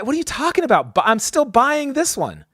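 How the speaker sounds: retelling an angry outburst at the clerk; angry retort. Retold or imitated. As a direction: fire back in outrage. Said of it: "I'm furious".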